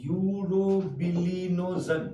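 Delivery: urobilinogen drawn out very slowly, syllable by syllable; slow, deliberate teaching pronunciation of urobilinogen. Shown as a demonstration. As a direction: dictate urobilinogen slowly for students to catch. Teacher spells out new term slowly.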